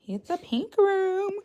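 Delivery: slow high cutesy announcement; high sing-song. Shown as a demonstration. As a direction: stretch it out high and sweet. Showing her room on stream.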